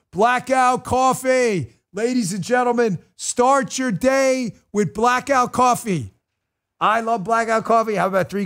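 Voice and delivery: big, slow, high announcer call; hyped ad read. Acted. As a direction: sell it like a stadium announcer.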